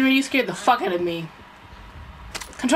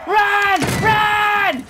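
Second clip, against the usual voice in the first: shouting; 10 semitones higher, 6 dB louder than usual. Real, not acted. Urgent callout to teammates.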